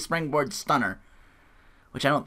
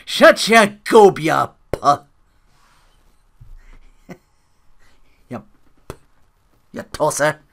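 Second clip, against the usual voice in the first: loud, slow mock Irish accent with laughter breaks; 8 dB louder than usual. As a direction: bellow the Irish put-down, cracking up. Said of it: "careful when I do accents".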